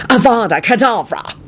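high, forceful spell cry; casting a curse. A narrator's reading. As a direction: hurl the curse at an enemy. Villain casts a killing curse.

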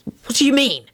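a touch taken aback; startled. A narrator's reading. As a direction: pitch up as if caught out. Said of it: "he said in a startled voice".

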